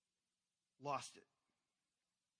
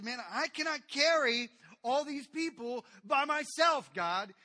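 much louder, slow, high protest to God; angry Moses; 9 semitones higher, 15 dB louder, slower than usual. Retold or imitated. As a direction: plead at the top of your voice. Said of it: "He's angry and the burden".

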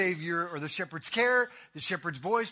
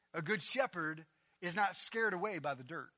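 usual speaking voice

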